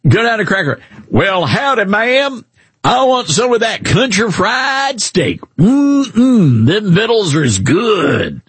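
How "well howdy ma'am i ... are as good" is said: high, drawn-out country Southern twang; mock down-home Southern drawl; overdone Southern accent. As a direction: play a hammed-up Southern diner customer. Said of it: "you don't talk like that".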